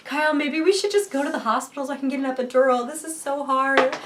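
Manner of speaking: high, loud mock whine; half-joking plea. Labouring woman begs husband for the hospital, half joking. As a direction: exaggerate the plea, dragging out the final complaint. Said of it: "I'm, like, half joking".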